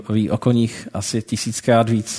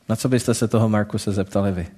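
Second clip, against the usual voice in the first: usual speaking voice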